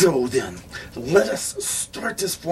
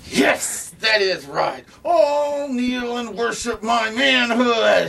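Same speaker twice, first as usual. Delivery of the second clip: booming, high, slow and loud; grandiose proclamation; 9 semitones higher, 4 dB louder, slower than usual. Acted. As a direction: proclaim it like a vain emperor. McCain commanding worship, pompous.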